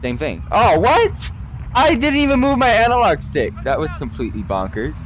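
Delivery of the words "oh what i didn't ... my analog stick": outraged disbelief. Real, not acted. Outraged at game.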